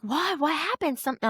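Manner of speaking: high-pitched, worried retold questions; alarmed questioning. Friend warned of bad news, alarmed. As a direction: imitate a friend suddenly alarmed. Friend reacts to ominous warning.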